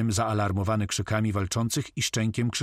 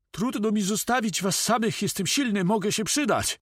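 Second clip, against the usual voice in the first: very high-pitched character voice; pleading helper; 13 semitones higher than usual. A narrator's reading. Moko pleads to stay and help.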